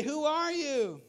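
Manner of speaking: high questioning holler; alarmed call back. Acted. Climber to the unseen voice, high alarmed call. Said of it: "and you say".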